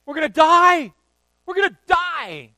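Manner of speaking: very loud, high screaming in terror; panicked scream. Retold or imitated. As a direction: scream like the boat is going down. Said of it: "running around ... like a chicken with their head cut off, saying".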